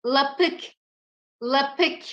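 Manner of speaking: ordinary talk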